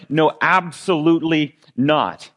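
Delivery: slow, weighty "No, absolutely not" with both words leaned on; emphatic no. Retold or imitated. Peter flatly refusing Jesus, slow emphatic no. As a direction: lean hard on "No" and "absolutely". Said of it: "that no is like this emphatic kind of double negative".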